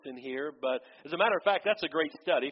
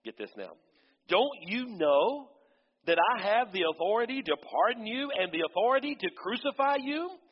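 indignant Pilate; 8 semitones higher, 4 dB louder than usual. Acted. Pilate pressing the silent Jesus, high indignant challenge. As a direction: demand it from a silent prisoner. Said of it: "Pilate said".

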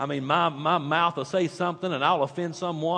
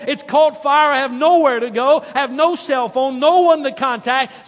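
higher, louder pleading rant; complaining to God; 8 semitones higher, 7 dB louder than usual. Retold or imitated. Stranded man venting at God, loud lament. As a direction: pour out the woes to heaven. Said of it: "he looks up at God and he said".

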